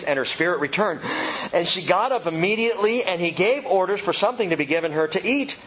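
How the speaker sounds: ordinary talk, nothing marked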